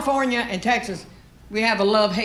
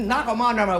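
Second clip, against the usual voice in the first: usual speaking voice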